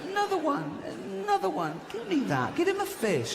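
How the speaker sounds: high, greedy grabbing pleas. Acted. Greedy man voiced.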